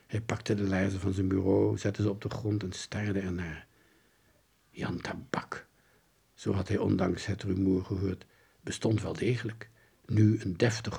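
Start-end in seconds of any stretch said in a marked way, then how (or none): 4.7-5.7 hushed whisper of the name. Acted. Detective murmuring the name he overheard. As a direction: breathe the name.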